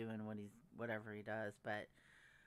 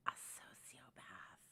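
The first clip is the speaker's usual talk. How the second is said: whisper; 7 semitones lower, 8 dB quieter, slower than usual. Real, not acted. Ominous whispered aside.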